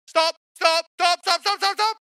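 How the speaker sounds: high, loud, rapid-fire imitation of a car alarm; alarm-like stop. Reversing sensors warning the driver, frantic mock alarm. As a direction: repeat it like an insistent high beeper. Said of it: "they might as well be going".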